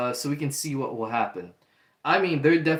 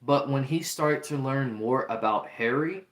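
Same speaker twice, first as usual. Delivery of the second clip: ordinary delivery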